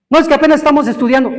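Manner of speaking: high, rushed mock protest; student's excuse. Student dodging marriage because of studies, hurriedly protesting.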